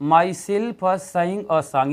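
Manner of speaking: very slow, word by word; slow board reading; slow dictation. Shown as a demonstration. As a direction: dictate each word for the class. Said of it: "यहाँ पर लिखे हैं कि".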